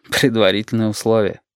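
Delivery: plain reading voice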